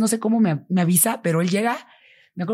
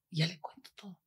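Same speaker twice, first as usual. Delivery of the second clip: ordinary talk, nothing marked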